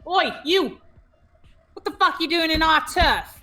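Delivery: put-on loud, high East London street-kid challenge; East London accent. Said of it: "I don't know why this has an accent".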